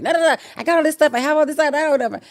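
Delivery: high, panicked protest; frantic denial. Retold or imitated. Accused shopper panicking at the police, frantic denial. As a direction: talk fast and rattled. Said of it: "I just went crazy".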